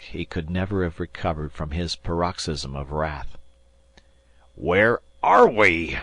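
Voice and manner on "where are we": slow, loud angry bellow; captain purple with rage; red-faced roar; furious repeat. A narrator's reading. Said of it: "he repeated, with purple face".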